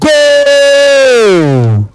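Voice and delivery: long drawn-out goal shout over the crowd; commentator's drawn-out GOAL; goal call. Real, not acted. Goal scored in penalty shootout.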